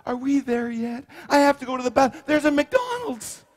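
put-on high, whiny child asking are we there yet; whiny kid in the car. Preacher to wife, playing a whiny child.

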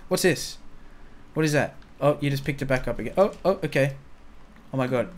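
normal talking voice

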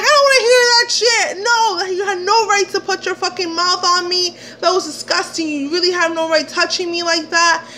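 high, indignant retold tirade; ranting at him. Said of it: "ramming him a new one".

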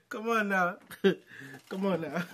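ordinary delivery

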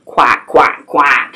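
low, mock duck quacking. Duck sound in picture book.

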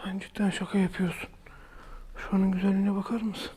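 whispered amazement; awed whisper. Real, not acted. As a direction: whisper it in disbelief. Amazed at the cave. (hayretle fısıltı)

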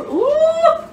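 high squeal of delight; little scream. Real, not acted. Cook squeals as the croutons come out perfect, a high delighted ooh. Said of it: "I'm screaming again".

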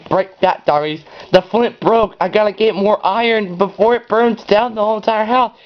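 light panicked gamer rush; playful panic. Real, not acted. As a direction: scramble like the fire is on your heels. Panicking as fire spreads.

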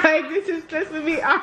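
laughing, stressed out. Real, not acted. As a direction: complain while cracking up. Laughing through stress at game.

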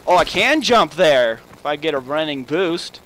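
usual speaking voice

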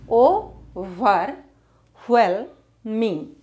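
Overwhelming slowly in four beats; syllable by syllable. Shown as a demonstration. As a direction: pause after each syllable of Overwhelming. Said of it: "how to divide words".

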